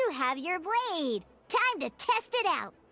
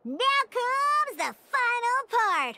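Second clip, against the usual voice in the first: high, slow, louder build-up; excited announcement; 5 semitones higher, 4 dB louder, slower than usual. Acted. Guide hypes the final lesson step, slow and loud.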